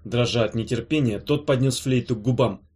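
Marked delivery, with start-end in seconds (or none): none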